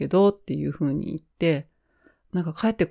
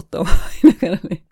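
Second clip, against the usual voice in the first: laughing as she tells it; a real laugh through the words; 8 dB louder, faster than usual. Real, not acted. To listeners, admits her excitement, laughing.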